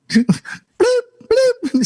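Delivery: high-pitched vocal "bloop"; imitated censor bleep.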